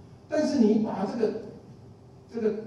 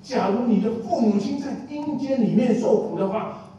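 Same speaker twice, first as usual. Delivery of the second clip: normal talking voice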